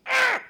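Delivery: loud high yelp; startled scream. Retold or imitated. Comic reenacts yelling at the TV. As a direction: yelp at the big play.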